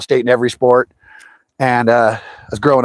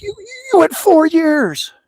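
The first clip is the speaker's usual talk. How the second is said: slow, much higher mock amazement; astonished; slower than usual. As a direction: marvel at it, drawn out and high. Amazed at dad's record.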